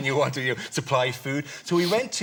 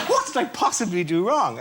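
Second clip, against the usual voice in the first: baffled plea; 8 semitones higher, 4 dB louder than usual. Retold or imitated. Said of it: "I said".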